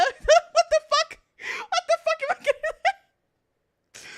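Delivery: high, slow squealing laugh through the words; real laughter breaking into the words; laughing hard. Real, not acted. Streamer cracking up over chat calling her British, squealing laugh.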